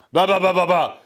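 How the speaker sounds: frustrated blah blah. Real, not acted. Frustrated at his own stumble.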